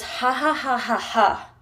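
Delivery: spoken-out mock laughter; imitated laugh. Boyfriend responding to her video, imitated laugh. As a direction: sound out his laugh syllable by syllable. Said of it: "he goes".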